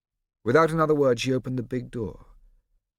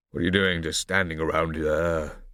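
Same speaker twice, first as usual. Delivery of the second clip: impatient father; 4 semitones lower, 3 dB louder than usual. A narrator's reading.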